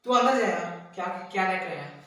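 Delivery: loud, impatient dismissal; annoyed shout, quickening; irritated order. Acted. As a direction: send them inside sharply and loudly. Orders onlooker to go inside.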